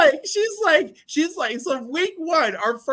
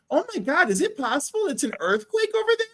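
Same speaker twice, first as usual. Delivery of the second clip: much higher, startled mock-worry; alarmed bosses; 9 semitones higher than usual. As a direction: gasp it out in rising alarm. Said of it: "my bosses are like".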